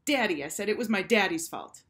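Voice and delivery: loud blurted "daddy"; sudden excuse. A narrator's reading. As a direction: blurt it out like a bright idea.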